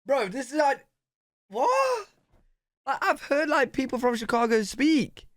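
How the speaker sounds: high, loud stunned cry of disbelief; a real shocked yelp; stunned shout. Real, not acted. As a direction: yell it out, mind completely blown. Mind blown by accent reveal.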